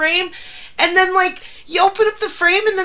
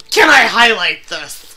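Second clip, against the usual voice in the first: mocking quote; 8 semitones lower than usual. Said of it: "You're like".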